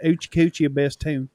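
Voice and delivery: Ochi Kuchi in a Southern accent. Shown as a demonstration. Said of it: "we gotta say it with a Southern accent".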